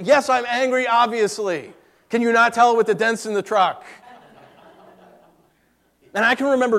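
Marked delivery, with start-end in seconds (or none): 0.0-3.8 sharp, raised, higher retort to the coworkers; angry retort. Retold or imitated. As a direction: snap back, fed up and heated. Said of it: "I was angry. I was ticked".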